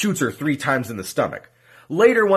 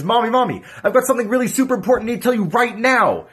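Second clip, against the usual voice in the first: put-on excited child; 7 semitones higher than usual. Second son runs to mother.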